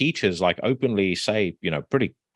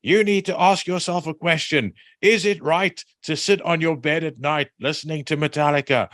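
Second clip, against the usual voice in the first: preachy teacher quote; 7 semitones higher than usual. Retold or imitated. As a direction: warn the kids like a preacher. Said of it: "he says".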